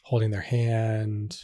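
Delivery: guided meditation. Acted. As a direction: ease the listener gently back into the room.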